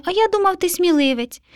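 high, disappointed little-mouse voice; saddened child. A narrator's reading. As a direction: let down by daddy, childlike. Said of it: "засмутилося Мишеня".

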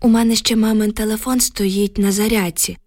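ordinary delivery

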